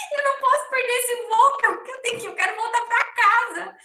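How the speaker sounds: high, pleading voice close to tears; retold crying. Begging the gate attendant not to miss the flight, high and tearful. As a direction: beg through the sobs. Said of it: "Aí eu comecei a chorar de novo".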